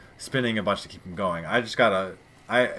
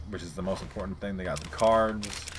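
usual speaking voice

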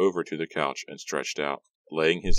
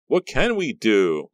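slow, higher whine; whining; 6 semitones higher, 6 dB louder, slower than usual. A narrator's reading. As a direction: whine it helplessly. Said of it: "Maya whined".

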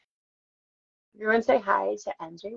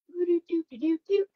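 dial-up hum; 11 semitones higher than usual. Retold or imitated. Mimicking old internet pages.

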